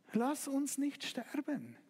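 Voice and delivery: slow, hushed desperate prayer. Acted. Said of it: "Und du fängst an zu denken". (leises, langsames Flehen)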